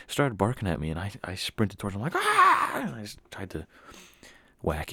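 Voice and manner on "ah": high, loud charging "Ah!"; battle yell. Acted. Boy sprinting at a barking dog, battle yell. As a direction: scream as you rush at it.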